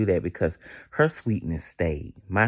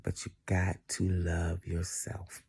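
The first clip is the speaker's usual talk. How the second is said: soft, breathy whisper to close; 10 dB quieter than usual. Real, not acted. Signing off to Porch Chronicles viewers in a whisper. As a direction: lean in and whisper it gently. Tender sign-off to her viewers.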